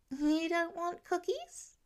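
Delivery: put-on high, slow little-boy voice, let down; a small kid whose hopes just sank; disappointed child. A narrator's reading. Nate asking Pete about cookies, high and crestfallen. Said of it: "Nate's face fell".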